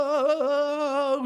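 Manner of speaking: high long whoa; coaster whoa. Acted. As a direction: ride the drop. Roller coaster drop.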